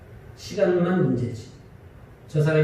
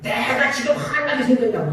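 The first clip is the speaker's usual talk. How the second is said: mock angry retort; 6 semitones higher than usual. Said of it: "왜 저렇게 화를 내실까".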